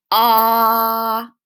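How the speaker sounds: loud steady ah so the throat buzzes; voiced ah. Shown as a demonstration. Teacher to children showing a voiced sound, loud ah. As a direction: hum out a loud ah to feel it. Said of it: "put your hand on your ... voice box here and say".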